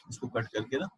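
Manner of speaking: far from mic. Real, not acted. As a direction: speak as if the mic drifted away. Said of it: "sir, आपकी mic थोड़ा दूर हो गया है".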